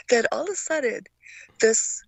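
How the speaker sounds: normal talking voice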